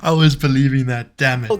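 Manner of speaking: co-host protests that he fell for the made-up story; mock outrage. Real, not acted. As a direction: protest loudly that you fell for it. Caught out by a joke.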